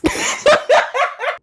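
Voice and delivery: high squealing laughter; shrieking laugh. Real, not acted. Laughing at racist remark.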